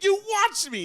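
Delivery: very high, loud exasperated comeback; indignant protest. Retold or imitated. Golfer to the friend calling him a liar, indignant. Retold argument over a golf score.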